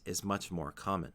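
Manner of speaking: ordinary talk, nothing marked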